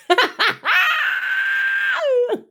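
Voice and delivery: long, high-pitched cackle held out; high shrieking laugh. Real, not acted. Host looks at Bobby, long real shriek of laughter. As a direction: burst into a sustained squealing laugh.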